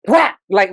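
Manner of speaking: punchy spoken whap for a slap; slap sound. Retold or imitated. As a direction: smack the word out like a slap on the stand. Band director slaps music down.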